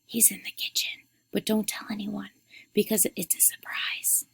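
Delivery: soft, secretive whisper; hushed and confiding. A narrator's reading. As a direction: whisper a secret into a friend's ear. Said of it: "whispered into Rosura's ear".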